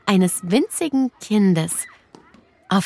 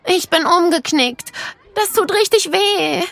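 put-on hurt little girl; 10 semitones higher than usual. A narrator's reading. Clara hurt her ankle.

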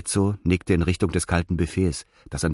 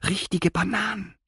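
high, quivering with craving; trembling voice; 14 semitones higher, 3 dB quieter than usual. A narrator's reading. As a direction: let the voice shake on Bananen. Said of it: "Etzos Stimme zitterte". (zitternde, hohe Stimme)

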